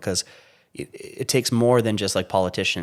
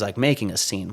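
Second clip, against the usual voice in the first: normal talking voice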